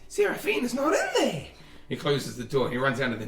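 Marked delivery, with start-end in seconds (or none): 0.1-1.4 high-pitched comic alarm; gasp, then squeal it out in disbelief; shocked outburst. Acted. Meat Shield finds the room empty.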